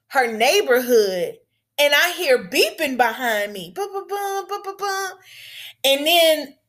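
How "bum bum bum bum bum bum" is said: high, soft mock horn beeps; imitated horn beeps. Driver hears a car honking behind her, soft high beep imitation. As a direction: tap out the beeping behind you, high and light.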